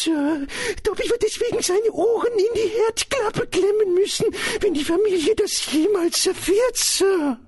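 very high-pitched, anguished elf voice; high creature voice, fretting; Dobby, horrified house-elf. Acted. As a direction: protest in dread as a little servant elf. Narrator voices the frightened house-elf.

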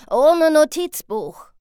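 teacher calling a warning after the pupil; calling after her. Acted. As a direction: call it after her as she leaves. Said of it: "rief er mir nach".

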